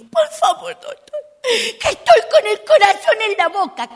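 high, crying mock plea of a mother; desperate mother. Mother begs the doctor about her sick girl, high teary mock plea. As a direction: whimper it like a panicked mom. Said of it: "la madre dijo".

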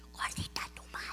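a hissed aside under the breath; whispered insult. Acted. Girl insults the auctioneer, whispered under breath. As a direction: whisper the comeback so he won't hear.

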